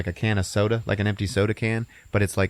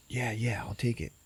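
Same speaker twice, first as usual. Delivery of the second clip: hushed stoner; 3 semitones higher, 7 dB quieter than usual. Retold or imitated. Stoner buying drugs.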